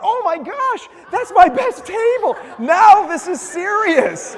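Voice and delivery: imitating the wife, high and loud; wife freaking out. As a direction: play the panicked wife, half-laughing. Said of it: "his wife starts freaking out".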